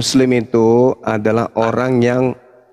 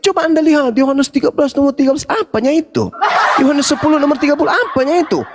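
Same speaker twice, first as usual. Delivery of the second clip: preaching; 13 semitones higher than usual. Acted. Heated religious debate preaching.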